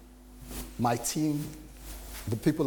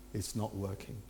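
dejected admission; 4 semitones lower, 12 dB quieter than usual. Retold or imitated. Admitting failure to his boss.

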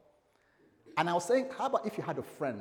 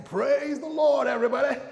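American preacher; 12 semitones higher, 11 dB louder than usual. Retold or imitated. Pastor imitating an American church greeting, loud and high. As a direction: belt it out like a hype American preacher. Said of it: "where they'll be saying".

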